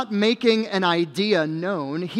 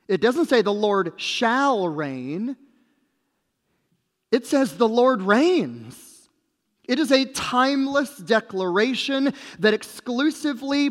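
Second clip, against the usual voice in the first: emphatic preaching; 4 semitones higher than usual. Real, not acted. Preacher declaring the Lord's timeless reign, emphatic. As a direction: declare it firmly, punching key words.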